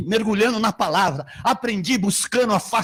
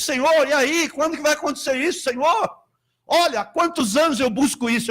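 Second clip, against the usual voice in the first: higher, impatient plea to the Lord; pleading with God; 7 semitones higher than usual. Retold or imitated. Pastor retelling his complaint to God, impatient plea. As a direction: press God for an answer. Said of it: "eu falo com Deus".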